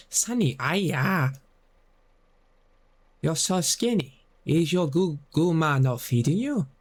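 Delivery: lightly voiced mock Chinese aunt, hammed-up; mock Chinese accent. Shown as a demonstration. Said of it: "You have to say".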